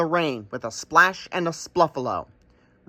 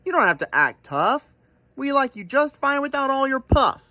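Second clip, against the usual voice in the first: a higher, gentle ram voice; kind ram; 10 semitones higher than usual. Acted. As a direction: reassure the buffalo kindly. Ram reassuring.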